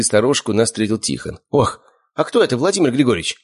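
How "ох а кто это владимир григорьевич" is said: higher-pitched startled question; taken aback, pitch lifted; surprised. A narrator's reading. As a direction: ask in mild astonishment. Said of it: "удивился он".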